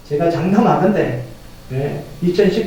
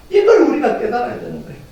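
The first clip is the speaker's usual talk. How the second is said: ordinary talk, nothing marked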